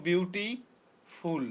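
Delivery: "Beautiful" split in two, pausing before ফুল; slow "beautiful" in two separate chunks; two syllables. Shown as a demonstration. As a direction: say beauty, pause, then ফুল. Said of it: "দুইটা".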